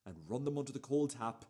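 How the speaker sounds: normal talking voice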